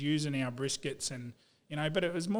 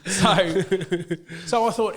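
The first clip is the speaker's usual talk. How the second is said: laughing through the words, louder and higher; 5 semitones higher, 9 dB louder, slower than usual. Real, not acted. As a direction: still cracking up at his own joke. Laughing at his own joke.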